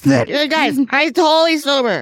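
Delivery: hammed-up sober act; mock drunk denial. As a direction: play an alcoholic insisting to the family. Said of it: "something an alcoholic says to their family".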